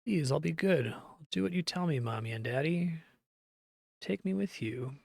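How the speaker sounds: understated tearful plea; tearful begging. Acted. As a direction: beg like a child promising to be good. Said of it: "Her cries mingle with tears".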